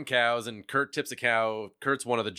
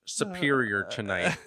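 higher, put-on actress impression; Winona Ryder; 4 semitones higher than usual. Podcaster redoing Veronica's line for laughing co-hosts, higher put-on impression. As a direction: do the film heroine's line in a higher voice. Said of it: "When I close my eyes, it's like Winona".

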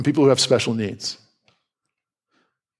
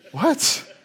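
high, baffled "what?" with a laugh; mock confusion; 12 semitones higher than usual. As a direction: play the puzzled listener. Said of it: "you're like".

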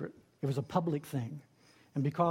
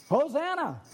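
high, loud cry of a sinking man; Peter crying out; 10 semitones higher, 5 dB louder than usual. Acted. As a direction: shout it like you're going under. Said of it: "he cries out".

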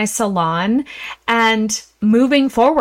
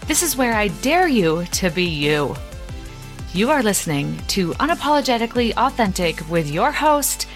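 podcast intro read; 3 semitones lower, 4 dB quieter than usual. Acted. Recorded podcast intro over music.

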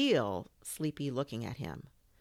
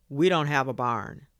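louder, firm objection; a parent shooting down a request; firm parental refusal; 8 dB louder than usual. Acted. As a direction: lay down the law to a child.

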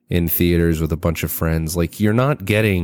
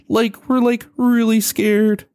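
much higher, slow, dopey Scooby-Doo stoner voice; Shaggy voice; 3 dB louder, slower than usual. Retold or imitated. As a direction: stretch it out like a goofy cartoon slacker. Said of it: "So it kinda sounded like Shaggy".

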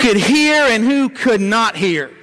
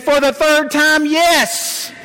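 annoyed wife; 6 semitones higher, much slower than usual. Retold or imitated. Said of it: "the most annoyed look on her face".

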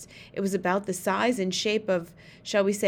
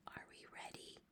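tiny breath-only whisper; barely audible whisper; 28 dB quieter than usual. A narrator's reading. Sophie asks the giant if they're ready, barely audible whisper. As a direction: whisper it as softly as possible. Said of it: "She spoke so softly".

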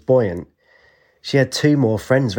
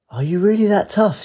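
put-on high girl voice; 11 semitones higher than usual. Child asks badger, much higher.

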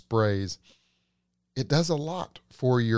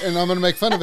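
laughing; 6 semitones higher, 4 dB louder, much faster than usual. Real, not acted. Laughing while talking.

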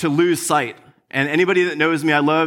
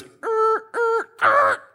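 very high buzzing electronic "Er, er"; imitated machine glitch; much slower than usual. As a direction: mimic a vending machine dying. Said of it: "the thing goes".

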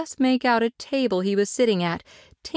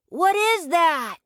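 calling out; 10 semitones higher, slower than usual. Acted. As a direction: call out into the fog. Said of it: "he called out".

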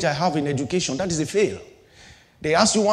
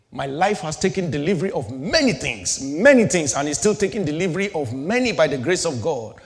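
normal talking voice

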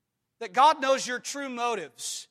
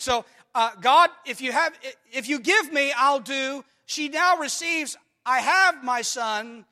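loud, driving sermon delivery; full-force preaching; 3 semitones higher, 7 dB louder than usual. Acted. Preacher reading Hannah's vow to the church, loud and fervent. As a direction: proclaim the verses with force.

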